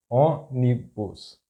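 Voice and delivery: ônibus slowly split, ô - ni - bus; syllable by syllable. Shown as a demonstration. Teacher breaking down an example word, ônibus slow in syllables.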